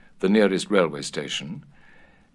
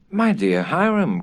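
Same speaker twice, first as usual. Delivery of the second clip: higher and louder plea to husband; cry; 8 semitones higher, 4 dB louder than usual. A narrator's reading. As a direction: call out to him in dismay. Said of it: "cried Missus Otis".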